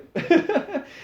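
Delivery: high burst of laughter; a quick bright laugh. Real, not acted. Laugh after kill remark.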